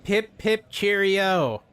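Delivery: high, drawn-out joke British catchphrase; hammed-up pip-pip Englishness; mock British cheer. Mocking the traveler's British eatery, exaggerated cheery. Mocks a British place name.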